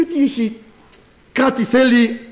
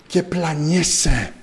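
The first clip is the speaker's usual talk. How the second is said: ordinary talk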